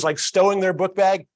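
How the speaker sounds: ordinary talk, nothing marked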